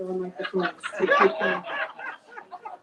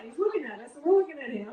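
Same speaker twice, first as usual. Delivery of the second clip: ordinary delivery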